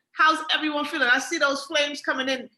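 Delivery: normal talking voice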